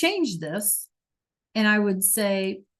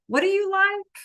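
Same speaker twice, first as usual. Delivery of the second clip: put-on much higher kid voice asking what do you like; 11 semitones higher than usual. Kid on the playground who didn't hear. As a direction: pitch up into a curious kid. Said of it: "some other kid says".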